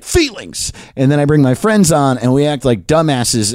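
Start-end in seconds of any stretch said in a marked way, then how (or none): 0.0-0.7 high, soft little mock cry; mock sensitive outburst. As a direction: blurt the word small and high. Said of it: "And then I'm like".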